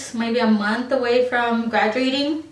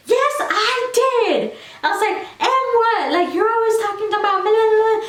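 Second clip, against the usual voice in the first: screaming back; 11 semitones higher than usual. Retold or imitated. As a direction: shout it back at her in front of everyone. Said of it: "We were screaming at each other".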